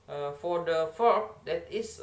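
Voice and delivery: ordinary delivery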